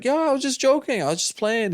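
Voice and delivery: high-pitched, backpedaling protest; mock pleading. Man at gunpoint claims he was joking, mock pleading. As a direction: imitate a man hastily backing down, voice jumping up high.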